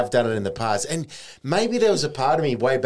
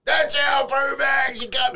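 slow, much higher indignant shout from upstairs; neighbour yelling down; 13 semitones higher, slower than usual. Retold or imitated. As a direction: yell it down from two floors up. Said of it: "This dude from two floors up".

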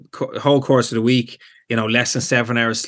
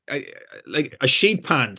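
normal talking voice

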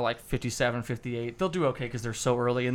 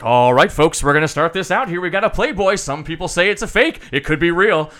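loud, raised auctioneer patter; 5 semitones higher, 10 dB louder than usual. Shown as a demonstration. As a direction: work the crowd like an old-time auctioneer. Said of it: "that's a lot of times how they say it".

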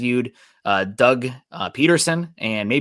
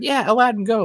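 high-pitched mock cheer; Genie cheering; 13 semitones higher than usual. Genie rooting for his hero, mock excited cheer. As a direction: cheer him on in a much higher voice. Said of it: "the genie's cheering for him".

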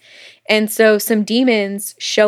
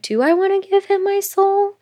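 high wondering voice, lifted up; quoted musing; 10 semitones higher than usual. Retold or imitated. She wonders aloud about her soul, high and wondering. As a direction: voice her asking herself, pitched high. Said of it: "she'd been like".

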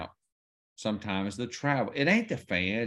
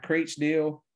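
normal talking voice

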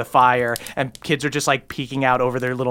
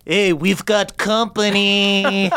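higher, drawn-out call; kid raising the alarm; 5 semitones higher, slower than usual. Acted. Kid warning the others of intruders, drawn-out call. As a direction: call it out slowly to the gang. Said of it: "one kid turns around and goes".

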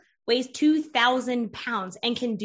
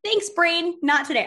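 higher, chirpy dismissal; breezy brush-off; 5 semitones higher, 4 dB louder than usual. Acted. Coach talking back to her brain, breezy brush-off. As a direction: send the brain packing with cheery thanks.

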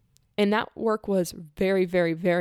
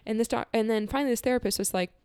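usual speaking voice

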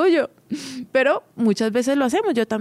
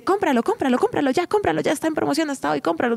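higher, insistent sales pitch, rushing the promo line; pushy seller; 6 semitones higher, faster than usual. Retold or imitated. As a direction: push it like a vendor who won't let go. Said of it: "cuando alguien te dice". (vendedora insistente, más aguda, apurando la promoción)

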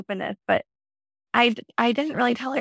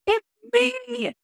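retold excited scream; much slower than usual. To friend Rebecca on the beach, high excited mock scream. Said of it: "I just started screaming at her".

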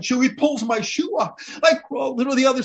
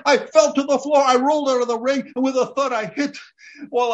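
ordinary talk

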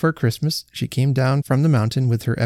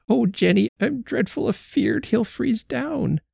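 put-on fretful old woman; 11 semitones higher than usual. A narrator's reading. Old Betsy frets to Jenny about her sulking husband, high and anxious. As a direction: let an anxious old wife's voice quaver.

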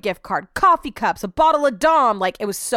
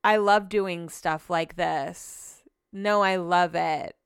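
slower and lower, no inflection at all; even flatter monotone guest; even more monotone; 3 semitones lower, much slower than usual. Retold or imitated. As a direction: say it like pulling teeth. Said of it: "that was even too much inflection".

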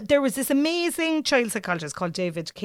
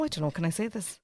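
usual speaking voice

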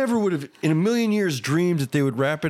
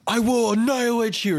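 high-pitched imitated yell, no real volume; half-voiced retold scream of a threat; mock scream; 10 semitones higher than usual. Jailed man threatening the cop, mock scream. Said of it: "he's, like, screaming something at him".

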